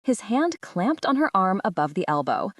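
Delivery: plain reading voice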